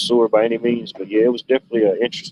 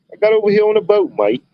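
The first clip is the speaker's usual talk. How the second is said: high, loud hammed-up Australian accent; mock Australian accent; 12 semitones higher, 4 dB louder than usual. Imagined Australian explaining his boat trip, in a loud mock Australian accent. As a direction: belt it out like a cartoon Australian.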